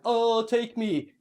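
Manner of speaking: slow, hammed-up dying-hero sigh; mock noble sacrifice. Friend mocking the film hero's last words, slow and hammed-up. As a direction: drag it out like a corny martyr. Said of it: "he just goes like".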